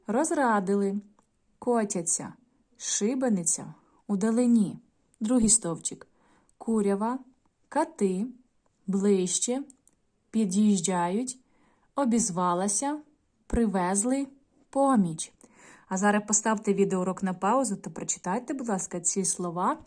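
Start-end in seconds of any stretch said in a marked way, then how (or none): 0.1-4.8 each word slow and clear, stress marked; correct stress drill. Shown as a demonstration. Teacher models correct stress for pupils to repeat. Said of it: "слова з правильним наголосом". 6.6-15.3 each word slow and clear, stress marked; correct stress drill. Shown as a demonstration. Teacher models correct stress for pupils to repeat. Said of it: "слова з правильним наголосом".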